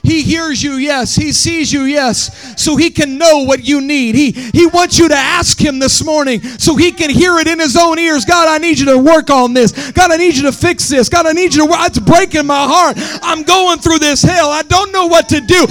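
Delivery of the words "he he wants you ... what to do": full-force preaching. Acted. Pastor voicing the church's prayer, loud preached cadence. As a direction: cry out to God on their behalf.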